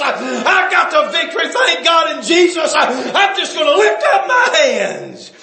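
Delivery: high, loud shouted proclamation; preaching at full force. Acted. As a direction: proclaim it like a triumphant psalm. Preaching David's words at full force.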